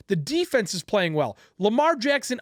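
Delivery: usual speaking voice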